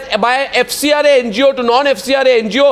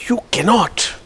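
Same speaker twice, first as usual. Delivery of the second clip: ordinary delivery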